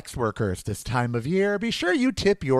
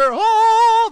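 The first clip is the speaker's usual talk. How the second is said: a sudden, much higher, louder "Oh"; Joanne Worley "Oh"; 9 dB louder than usual. Pretend. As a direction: throw out one huge, high "Oh" like a TV comedienne. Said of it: "Joanne Worley would be so proud".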